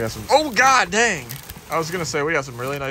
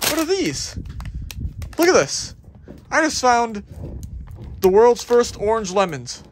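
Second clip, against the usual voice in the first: excited discovery; 9 semitones higher than usual. Real, not acted. Shopper thrilled at finding orange lemons in the bin, excited. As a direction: sound amazed at what you just dug up. Finding odd fruit while diving.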